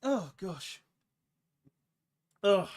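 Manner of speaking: holding back a sneeze, short gasps; fighting off a sneeze; about to sneeze. Real, not acted. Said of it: "I feel like I'm gonna sneeze".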